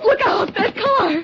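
high, loud alarmed cry of warning; frightened warning. Acted. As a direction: she suddenly sees the car and shouts to warn him. Warns of a car in danger.

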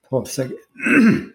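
real tears, pausing to gather himself; asking for a moment while overcome; choked up. Real, not acted. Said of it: "I'm sorry, man".

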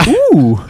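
high, loud mimicked ooh; playful high "ooh" in imitation; imitated squeamish "ooh". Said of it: "Jackie made a noise when some".

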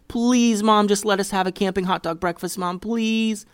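put-on high whiny child pleading; whiny begging kid. A narrator's reading. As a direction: beg like a whining kid. Kids begging mom for hot dogs.

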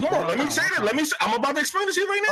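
high, pushed voice cutting through the noise. Real, not acted. Podcast host shouting over friends to explain. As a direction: raise it to grab the floor.

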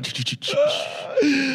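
high shrieking laugh; squealing laugh. Real, not acted. Breaks up reading the question.

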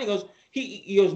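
ordinary talk, nothing marked

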